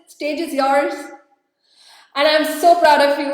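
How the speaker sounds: loud, high, proud send-off; proud announcement. Real, not acted. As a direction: hand her the stage with pride. Host hands stage to Francis.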